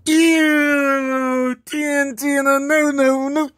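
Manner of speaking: high, drawn-out squeal of disgust; high-pitched squeamish refusal. Real, not acted. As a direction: recoil with a high "ew". Disgusted by a skin.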